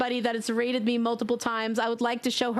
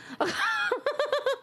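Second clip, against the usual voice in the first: high, giggly laugh; real laugh. Real, not acted. Streamer amused by a viewer's gift, laughing high.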